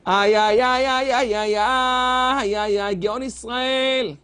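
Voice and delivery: long drawn-out lament, low-pitched; mournful imitation of weeping; retold wailing. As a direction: wail "ay, ay" in slow, stretched moans. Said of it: "הגיע התור של החפץ חיים לבכות".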